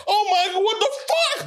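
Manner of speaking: friend's shocked reaction at the door, mock amazed. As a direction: squeal it out like you can't believe it.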